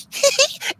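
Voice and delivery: very high, short giggle; mock girlish giggle. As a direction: imitate a cutesy little titter. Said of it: "come up to you like".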